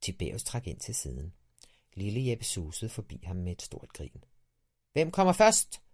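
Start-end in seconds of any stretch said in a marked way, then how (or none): 4.9-5.8 higher, louder racing call; shouted challenge. A narrator's reading. Little Jeppe shouts a race challenge while sledding past. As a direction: yell a dare while whizzing by. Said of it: "råbte han".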